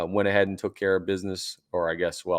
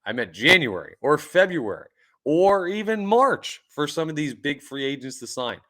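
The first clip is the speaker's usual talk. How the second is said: ordinary delivery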